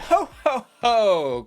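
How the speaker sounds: loud, hammed-up Santa laugh; playful Santa ho-ho. Reviewer introducing a Christmas runway look, playful Santa ho-ho. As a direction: boom out each "ho" like a mock Santa.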